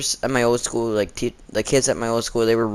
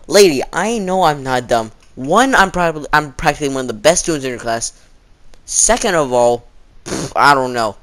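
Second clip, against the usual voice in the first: loud, raised retort; indignant rant; 4 semitones higher, 6 dB louder than usual. Retold or imitated. Retorting to the teacher who called him dumb, loud indignant.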